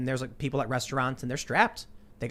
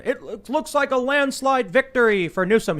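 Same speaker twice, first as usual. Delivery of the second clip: high, loud announcer call; hammed-up TV anchor announcement; news anchor voice; 10 semitones higher, 6 dB louder than usual. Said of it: "Wolf Blitzer comes on".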